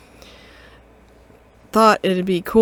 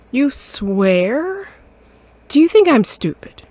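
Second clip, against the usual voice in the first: loud, scolding mother cat; 6 semitones higher, 4 dB louder, slower than usual. Acted. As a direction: tell off your grown son. Mother scolding her son.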